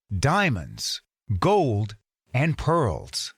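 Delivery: ordinary delivery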